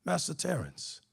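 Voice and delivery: lightly voiced old slave addressing master; old slave greeting.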